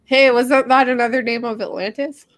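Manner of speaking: usual speaking voice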